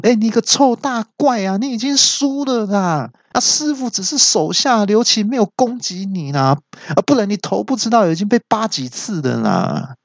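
high-pitched shouted jeering; high yelling taunt. Retold or imitated. Narrator as a kid yelling at the big monster, high jeering shout. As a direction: yell it high like a cheeky kid. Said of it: "我就当场大叫说".

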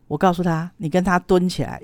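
ordinary talk, nothing marked

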